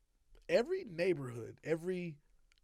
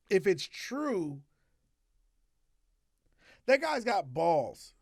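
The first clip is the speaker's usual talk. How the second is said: slow and emphatic; 3 semitones higher, 5 dB louder, much slower than usual. Real, not acted.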